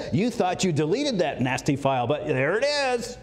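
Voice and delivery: playfully smug investigator; gloating. Acted. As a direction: crow over a find, stretching the last words. Mimics gloating investigators finding deleted files.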